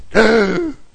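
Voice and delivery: big loud intake of breath; acted first breath after blacking out; gasp back to life. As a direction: suck in air as if reviving. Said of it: "breathes again".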